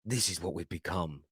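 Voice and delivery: low, quiet voice of a defeated fan; mock-crestfallen murmur; imitated disappointment. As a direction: voice his disappointment, hushed and low. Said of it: "you just see the disappointment in his face".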